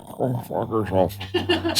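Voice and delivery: slow, soft, dragging mock buzzer. Dad imitating worn-out toy buzzer, slow and soft. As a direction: let the voice wind down like a dying battery. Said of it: "Sounds like Lewis is dying".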